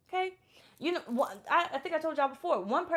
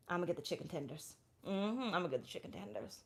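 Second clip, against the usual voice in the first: muttering to herself; 6 semitones lower, 11 dB quieter than usual. Retold or imitated. Ordering on a date.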